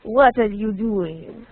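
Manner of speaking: put-on drawn-out French-accented English, haughty; mock-snobbish French accent in English; snobby French accent. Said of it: "it sounds very, um, snobby".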